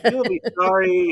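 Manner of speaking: high-pitched imitation of Harmony's threat; mock-threatening call, pitched high; mock yelling. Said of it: "Harmony being Harmony just yells out".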